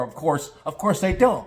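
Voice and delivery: ordinary talk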